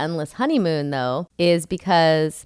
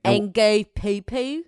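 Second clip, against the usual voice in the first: slow, stretched Australian vowels; Australian accent; 2 semitones higher, slower than usual. Shown as a demonstration. Finishing the improved attempt, Australian accent. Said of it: "That's better".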